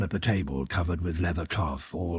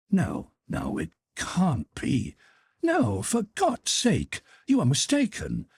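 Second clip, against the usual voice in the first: rising to a high, slow plea; anguished pleading; 12 semitones higher than usual. Acted. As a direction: implore him, building to a cry. Dolly begs him, hands to temples.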